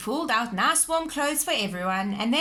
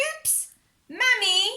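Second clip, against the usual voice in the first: high, loud call for Mummy; shouting; 12 semitones higher, 6 dB louder, slower than usual. A narrator's reading. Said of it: "shouted Peppa".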